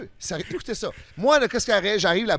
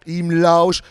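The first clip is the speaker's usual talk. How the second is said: ordinary talk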